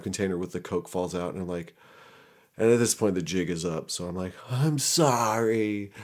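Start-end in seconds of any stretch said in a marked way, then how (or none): 4.5-5.9 high, very drawn-out tearful apology; mock sobbing. Caught suspect confessing as the jig is up, mock sobbing. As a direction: wail it slowly, hammed-up. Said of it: "I'm just sobbing".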